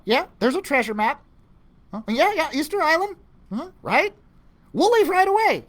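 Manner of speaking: high, quick Thea phone voice; hurried high phone chatter. Acted. One-sided phone call, high voice.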